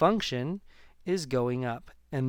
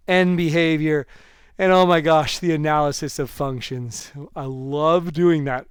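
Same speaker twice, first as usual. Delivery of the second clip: louder, bursting with real enthusiasm; gushing excitement; 2 semitones higher, 9 dB louder than usual. Real, not acted. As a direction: cry out with delight at the topic. Teacher gushes about function analysis.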